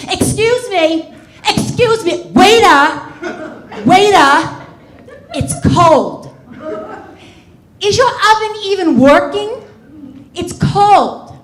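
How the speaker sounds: high, loud whiny nag; whiny complaining diner. Retold or imitated. Neurotic date complaining to waiter about cold souffle, high whine. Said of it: "calls the waiter over".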